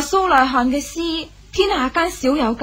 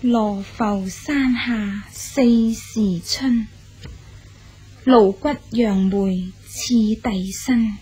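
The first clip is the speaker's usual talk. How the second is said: slow poem recital; 4 semitones lower, slower than usual. Acted. Girl reads the poet's new lychee poem aloud, slow measured recital. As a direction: read each line slowly and deliberately, pausing between lines.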